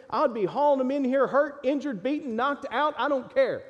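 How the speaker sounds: fiery pulpit preaching; full-force preaching. Acted. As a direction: lift it up and drive it home to the congregation.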